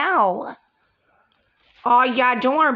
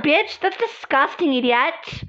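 ordinary delivery

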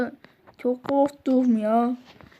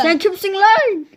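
angry shout; 7 semitones higher, 8 dB louder than usual. Acted. Teacher yelling at a latecomer, loud and angry. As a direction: bark "Sen kimsin lan?" loud and high, hitting "lan". Teacher confronts student, then whispers.

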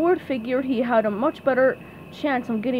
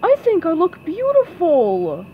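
louder, higher oafish voice; Patrick; 6 semitones higher, 6 dB louder than usual. Acted. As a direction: declare it as a proud simpleton. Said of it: "Patrick adjusted his crown".

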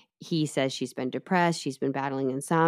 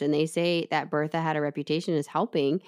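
ordinary delivery

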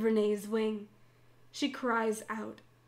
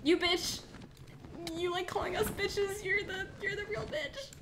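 mock insult; 7 semitones higher, 6 dB louder than usual. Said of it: "Just call her a bitch!".

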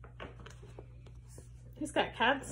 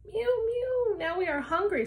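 high, loud little cat voices; mewing cats; 9 semitones higher, 5 dB louder than usual. Acted. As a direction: squeak it like thirsty kittens. Cats cry out in story.

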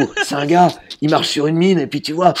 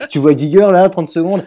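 ordinary delivery

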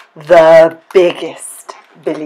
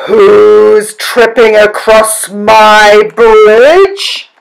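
loud, slow roar, pitched up; troll's roar; 4 semitones higher, 8 dB louder, much slower than usual. A narrator's reading. Troll challenges the second goat, roaring. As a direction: roar the challenge, stretching the words. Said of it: "again the troll roared".

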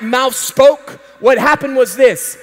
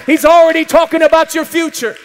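ordinary talk, nothing marked

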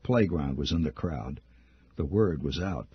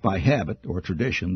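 usual speaking voice

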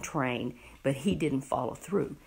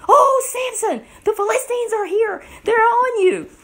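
very high, loud mock-female cry; woman's alarm call; 10 dB louder than usual. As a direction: call out a warning in a shrill falsetto. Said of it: "then that woman said to him".

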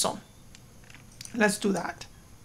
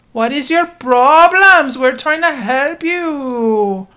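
louder and slow, exasperated; exasperated scolding; 4 semitones higher, 7 dB louder, slower than usual. Real, not acted. Scolding the sims who refuse help, exasperated. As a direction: scold them for not cooperating.

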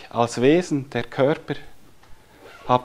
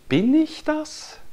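slow, much higher questioning Bin ich das; rhetorical wonder; slower than usual. Acted. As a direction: ask it in wide-eyed wonder. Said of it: "Oder wer ist das?". (langsam und staunend hoch gefragt)

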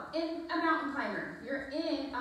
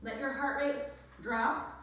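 ordinary talk

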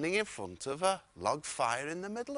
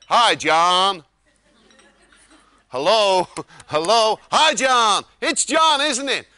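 booming friendly Texan hello; loud cheery greeting; 2 semitones higher, 11 dB louder, slower than usual. Retold or imitated. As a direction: beam it loudly down the phone. Cheery hotel worker wake-up call.

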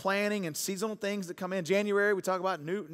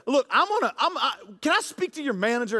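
mock indignant; 4 semitones higher than usual. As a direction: demand the manager half-laughing. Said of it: "And I said".

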